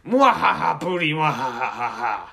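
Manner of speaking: evil laugh. Acted. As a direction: cackle like a cartoon villain. Hillary villain laugh.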